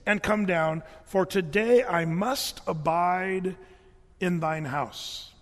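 slow, drawn-out, solemn call; Jesus calling up. Retold or imitated. Jesus calling Zacchaeus down from the tree, slow and solemn. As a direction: call him down slowly and gravely. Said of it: "and he says".

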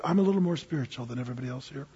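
quick, hushed mock aside; imitated churchgoer. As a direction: slip it out low and fast, imitating someone. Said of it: "that's really what they were saying".

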